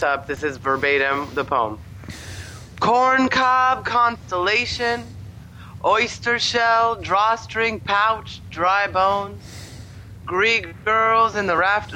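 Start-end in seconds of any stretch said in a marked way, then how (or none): 2.8-11.9 slow, ponderous mock recital; bad poet voice. Reading the poem verbatim to a friend, bad poet voice. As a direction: declaim each image like a bad poet. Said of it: "read it in a bad poet voice".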